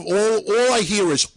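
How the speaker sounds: ordinary talk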